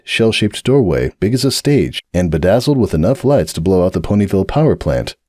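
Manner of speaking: ordinary delivery, nothing marked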